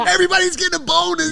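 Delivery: high, loud joyful whoop; excited. Pretend. Fighter imagining his payout, cheering high and loud. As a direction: cheer like you just got paid. Celebrating imagined big payday.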